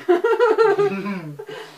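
high burst of laughter. Real, not acted. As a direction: squeal with laughter. Laughing at the game.

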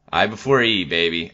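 ordinary talk, nothing marked

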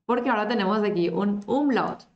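ordinary delivery

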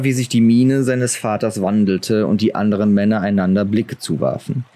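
ordinary delivery

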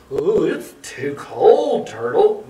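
slow, lightly growled bear voice; hint of a growly bear; growling bear. A narrator's reading. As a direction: grumble it slowly as a reluctant bear. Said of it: "Bear growled".

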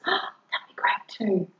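breathy excited whisper. Real, not acted. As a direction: whisper it like a little secret. Excited aside after a gasp.

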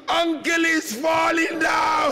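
high, slow, drawn-out cry of alarm; anguished call. Acted. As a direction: wail it out to an aunt, stretching each word. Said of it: "Sukaina called out".

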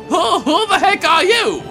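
ordinary delivery

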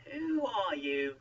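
high, loud, drawn-out question; awed questioning. A narrator's reading. Moses asks the mysterious voice, in loud awe.

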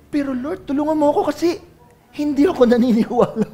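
acting out a hesitant confession; acted plea. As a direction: back off into a high, smaller-voiced plea. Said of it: "Kasi sabi niya".

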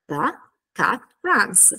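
slow THE, CAT, RUNS with falling end; word-by-word. Shown as a demonstration. Teacher breaking the answer into its parts, word by word. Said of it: "ci faremo anche un lavoro articolatorio".

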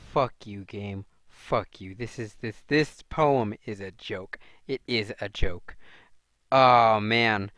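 ordinary talk

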